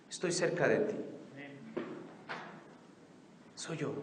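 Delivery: soft, gentle voice of comfort; tender comfort. Acted. Jesus speaking to a frightened believer, soft tender comfort. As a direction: speak like a caring friend close by.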